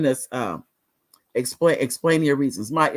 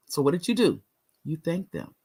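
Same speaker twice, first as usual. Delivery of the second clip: usual speaking voice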